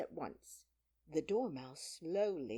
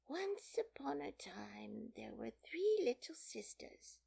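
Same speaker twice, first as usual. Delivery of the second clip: high, slow, whispery dormouse voice; half-asleep little creature; sleepy Dormouse; 9 semitones higher, slower than usual. A narrator's reading. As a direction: tell it drowsily in a feeble high voice.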